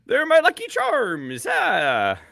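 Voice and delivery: leprechaun. Acted. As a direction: draw it out as a playful leprechaun. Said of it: "Give me a leprechaun".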